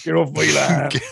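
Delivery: hammed-up rural West Country yokel; West Country accent. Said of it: "You can't do that in a West Country accent".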